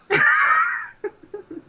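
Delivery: high, loud cackling laugh; real shriek of laughter; loud burst of laughter. Real, not acted. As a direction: laugh out loud at your own joke. Bursts out at own joke.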